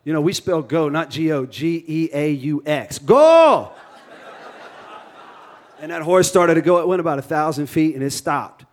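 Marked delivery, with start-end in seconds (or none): 3.0-3.7 high, loud story-time holler; yelled. Retold or imitated. Bride yells at the carriage horse, hammed-up holler. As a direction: yell it out like an impatient bride. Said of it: "Marie yelled".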